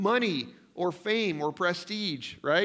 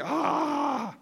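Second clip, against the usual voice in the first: frustrated groan; 8 semitones higher than usual. Retold or imitated. Frustrated at traffic stewing.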